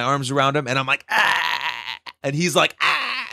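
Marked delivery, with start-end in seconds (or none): 1.1-2.0 high, held mock scream; acted-out fan scream, not full volume; excited yell. Acts out excited photo yell. 2.8-3.3 very high mock scream back; answering yell. Acts out singer yelling back.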